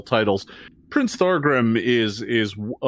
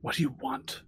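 much softer, guarded mumble; 6 semitones higher, 11 dB quieter than usual. Acted. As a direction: barely lift the voice, wary. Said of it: "and he's like".

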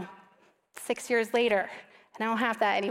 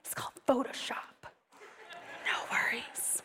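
a soft whisper, like sharing a secret; hushed whisper; 3 semitones lower, 10 dB quieter than usual. Retold or imitated. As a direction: whisper it to calm her down. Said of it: "my photographer goes".

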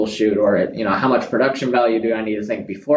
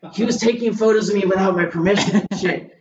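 put-on drunk guy; 8 semitones higher than usual. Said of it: "He's like".

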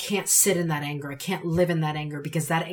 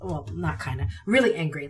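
usual speaking voice

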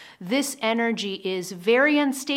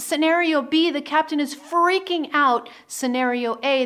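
normal talking voice

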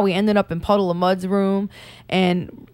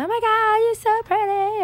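put-on gushing girly voice; 13 semitones higher than usual. Girls complimenting each other, quoted.

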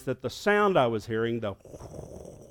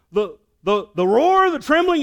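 higher and louder, tumbling out; excited confirmation; 11 semitones higher, 7 dB louder than usual. Retold or imitated. Pastor answering his brother-in-law, much higher and louder. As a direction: rush to agree.

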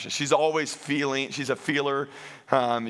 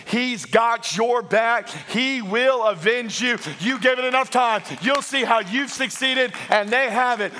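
impassioned preaching; 7 semitones higher, 4 dB louder than usual. Real, not acted. Preaches to rouse congregation.

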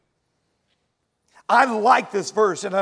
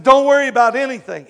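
loud, fast proclaiming of scripture; loud command; 5 semitones higher, 9 dB louder, faster than usual. Retold or imitated. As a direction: boom it out as God's command. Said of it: "He said".

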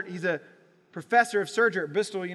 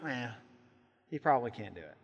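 doubtful scoff; 8 semitones lower, 6 dB quieter, faster than usual. Acted. Disciples doubting Peter at door.

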